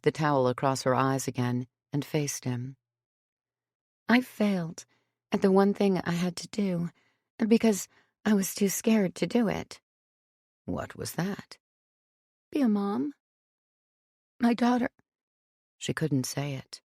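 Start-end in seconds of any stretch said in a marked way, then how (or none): 4.1-14.9 breaking down. A narrator's reading. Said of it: "the dam that had broken within her".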